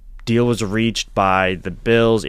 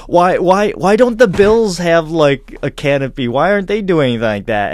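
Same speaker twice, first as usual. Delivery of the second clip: high, loud British protest; insistent put-on British questioning; British accent; 8 semitones higher, 6 dB louder than usual. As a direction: imitate a puzzled Brit, pitched up. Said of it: "give him my British accent".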